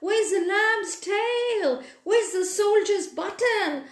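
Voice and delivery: high, indignant toy voices demanding answers; angry toys crowding round and shouting. Acted. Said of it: "The toys surrounded the cock jolly bird and shouted".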